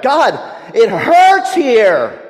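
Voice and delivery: slow, loud, drawn-out cry of pain; anguished cry. Acted. A hurting believer cries out to God, slow loud anguish. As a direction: wail it out like a hurting soul. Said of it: "when we cry out".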